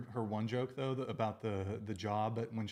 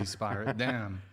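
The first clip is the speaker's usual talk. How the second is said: normal talking voice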